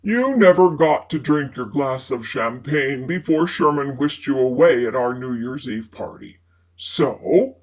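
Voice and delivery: low male character voice; man's voice. A narrator's reading. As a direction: voice Fitz low and smooth. Narrator voices Fitz, a man.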